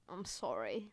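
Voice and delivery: ordinary delivery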